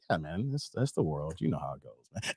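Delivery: very low, very soft, resigned; weary homeowner. Acted. Dave to the worried neighbor, low weary resignation. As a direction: let it drop low, uninterested.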